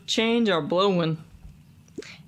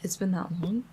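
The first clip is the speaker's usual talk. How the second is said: whisper; 6 dB quieter than usual. Real, not acted. Whispered aside.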